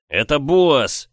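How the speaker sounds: slow furious shout; yelling. Acted. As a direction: bellow the name. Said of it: "заорал я".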